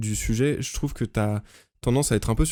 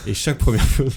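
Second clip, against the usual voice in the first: Morgan Freeman; 4 dB louder than usual. Pretend. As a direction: play a grand nature-documentary narrator. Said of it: "je suis Morgan Freeman". (voix de narrateur de documentaire)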